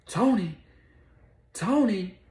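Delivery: high, loud call across the room. Retold or imitated. Retelling the show, calling Tony's name loudly. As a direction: shout the name to get him back.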